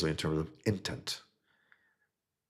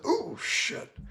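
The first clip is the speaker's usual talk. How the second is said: much higher, squeaky panicked "oh shit"; scared guy's squeal. Retold or imitated. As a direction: yelp it like a guy caught off guard. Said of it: "Guy's like".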